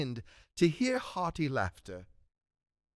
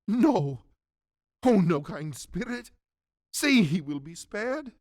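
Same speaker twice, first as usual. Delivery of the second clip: pleading; 6 semitones higher, 5 dB louder than usual. Acted. Scrooge pleads for Tiny Tim.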